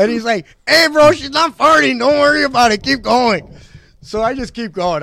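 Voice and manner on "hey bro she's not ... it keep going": high, loud bro voice; buddy voice. Retold or imitated. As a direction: play the buddy, pitched way up and loud. Buddy voiced in retold story.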